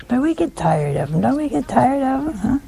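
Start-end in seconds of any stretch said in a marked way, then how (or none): none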